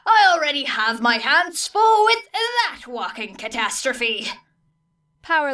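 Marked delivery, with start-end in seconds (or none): 0.0-4.3 a harried teacher at his limit, pushed a bit up and louder; exasperated engineer. A narrator's reading. Teacher complaining to Aizawa about his student, exasperated. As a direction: voice Power Loader fed up with the mess. Power Loader character voice.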